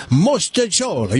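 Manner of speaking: slow, savoured Italian pronunciation; relished Italian. Shown as a demonstration. Host coaxing a caller to say it, Italian gusto. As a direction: roll it out like an Italian. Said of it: "You'll feel Italian".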